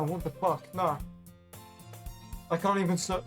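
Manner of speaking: usual speaking voice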